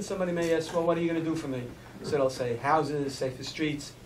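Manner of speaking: ordinary delivery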